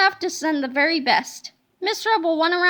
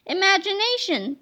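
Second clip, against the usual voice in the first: ordinary delivery, nothing marked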